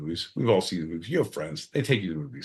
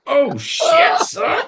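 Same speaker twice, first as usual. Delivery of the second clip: high, loud squeal of shock; mock shocked shriek; 8 dB louder, much slower than usual. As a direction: squeal it like you can't believe it. Surprised outburst at joke.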